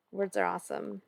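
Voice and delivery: ordinary delivery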